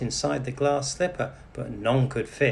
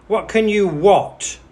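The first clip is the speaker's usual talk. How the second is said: angry stepmother; 7 semitones higher, 9 dB louder than usual. A narrator's reading. Stepmother bursts in angrily.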